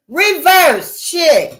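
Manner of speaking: emphatic call to turn back; emphatic exclaim. Real, not acted. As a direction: stretch each word for emphasis. Calling for a turnaround.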